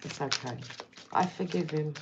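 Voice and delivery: normal talking voice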